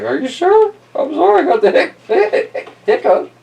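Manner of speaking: squeaky voice broken by hiccups; high and halting, hiccuping; high hiccupy elephant voice. Acted.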